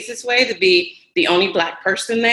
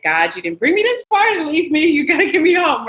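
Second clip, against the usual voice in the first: urgent plea; 9 semitones higher than usual. Retold or imitated. Praying lost on roadside.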